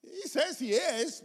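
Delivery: high, soft mocking doubt; skeptical onlooker. Non-Christians looking at a professed believer, mock doubt. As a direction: scoff softly in a high voice. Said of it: "They look at you and go".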